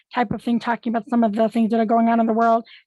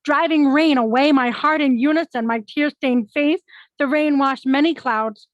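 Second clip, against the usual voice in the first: lilting verse, phrase by phrase; poem recital; 3 semitones higher than usual. Acted. Poet reading the same poem in reverse, lilting recital. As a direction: recite it with a measured poetic lilt.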